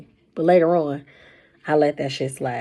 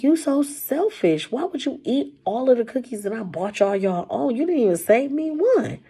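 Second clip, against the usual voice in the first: indignant complaint; 7 semitones higher than usual. Retold or imitated. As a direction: drag out the blame, pitched up. Retold complaint about eaten cookies.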